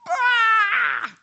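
high shouted cry. Retold or imitated. Angry man's outburst retold.

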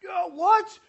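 high, loud indignant "what"; a kid taking offense; mock kid outrage. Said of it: "and I was like".

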